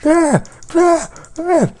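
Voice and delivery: short, very high loud yelps; frustrated wailing cries. Real, not acted. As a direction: let out repeated high wails. Cries out while dying in game.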